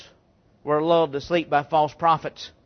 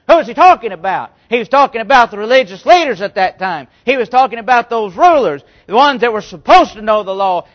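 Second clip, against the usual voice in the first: louder, higher preaching build; preaching at full force; 3 semitones higher, 7 dB louder than usual. Real, not acted. Preacher rousing the congregation, louder and higher. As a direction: drive it home to the congregation.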